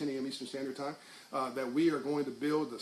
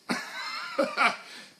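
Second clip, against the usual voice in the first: laughing; 4 dB louder than usual. Real, not acted. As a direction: burst of laughter at his own joke. Laughs at his own joke.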